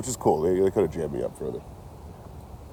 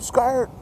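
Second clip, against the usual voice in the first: mock siren yelp; 4 dB louder than usual. Cop pulls up, siren-like yelp.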